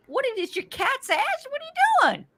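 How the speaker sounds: very high-pitched frantic questions; imitated shriek of alarm without much volume; retold panic. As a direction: re-enact a squealed, horrified protest. Retells panicking at husband.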